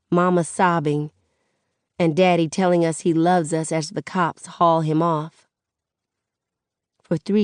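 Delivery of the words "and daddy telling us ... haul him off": ordinary delivery, nothing marked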